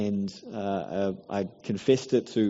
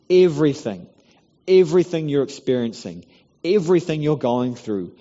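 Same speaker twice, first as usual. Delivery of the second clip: full-force preaching; 5 semitones higher, 7 dB louder than usual. Acted.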